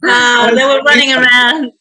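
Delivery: loud, high, gleeful ribbing of the group; boisterous mock complaint; excited teasing. Real, not acted. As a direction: tease them loudly, half laughing. Laughing tease about the weak players.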